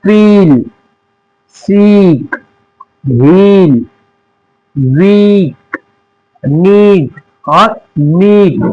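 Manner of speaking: फील, सीक, हील, वीक, नीड with the ee vowel drawn out long; long ee sound. Shown as a demonstration. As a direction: stretch the ee in each word slowly. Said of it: "यानी खींच के पढ़ते हैं". (ई को खींच कर, धीरे धीरे)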